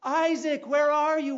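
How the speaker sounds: put-on loud, high calling for lost children; a frantic father calling his missing boys. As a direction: shout their names across the woods. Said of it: "Calling out".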